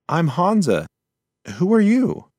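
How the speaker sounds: a tiny creature calling up nervously; little mouse Hansa. Acted. Mouse calls to hidden voice.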